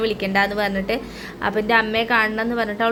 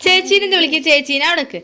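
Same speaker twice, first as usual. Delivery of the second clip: high, loud singsong questions; 9 semitones higher, 7 dB louder than usual. Real, not acted. Mother quizzes child.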